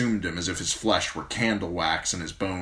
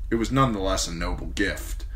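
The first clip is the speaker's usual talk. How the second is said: plain reading voice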